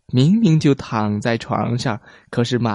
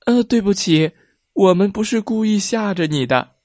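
put-on child voice; 7 semitones higher than usual. A narrator's reading. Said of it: "另一个声音说".

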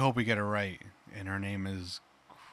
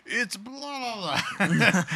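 mock listener; 11 semitones higher, 3 dB louder, much slower than usual. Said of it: "somebody that does listen to us is like".

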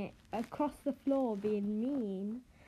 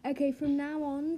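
ordinary talk

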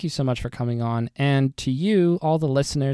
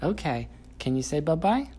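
soft sing-song encouragement; 4 semitones higher, 5 dB quieter than usual. Real, not acted. Parent coaxing toddler words.

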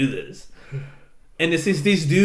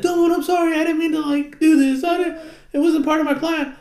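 put-on high, whiny-child apology; hammed-up squeaky apologizing; mock pleading kid. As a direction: plead like a guilty little kid.

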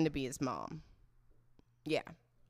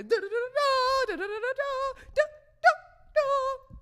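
very high-pitched slot-machine trill; imitated jackpot jingle; 4 dB louder than usual. How it sounds in her head, squealed jackpot jingle. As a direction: sing out jackpot bells, slowing at the end. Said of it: "that's how I hear in my head".

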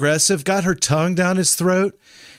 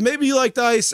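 teasing; 7 semitones higher than usual. Retold or imitated. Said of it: "That's what we said".

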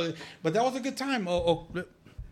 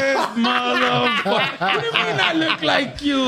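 laughing disbelief; 7 semitones higher, 5 dB louder than usual. Retold or imitated. Retelling his own laughing reply.

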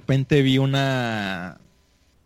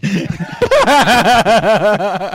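loud burst of laughter; 8 semitones higher, 8 dB louder than usual. Real, not acted. Laughing at his own story.

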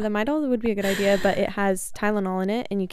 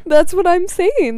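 excited; 8 semitones higher, 8 dB louder, slower than usual. Real, not acted. Emphatic agreement.

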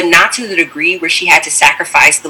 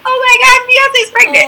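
much higher, overwhelmed gasp of joy; stunned excitement. Retold or imitated. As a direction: squeal it as the news finally lands. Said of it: "and I was like".

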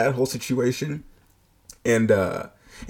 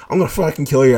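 louder furious threat; retold angry threat; 4 semitones higher, 8 dB louder, faster than usual. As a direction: threaten like she means it. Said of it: "She was like".

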